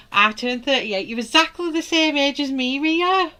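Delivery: thrilled to share the same age; excited greeting. Real, not acted. Greets live chat viewer excitedly.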